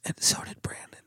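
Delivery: unvoiced stage whisper aside. Real, not acted. Teasing aside about co-host.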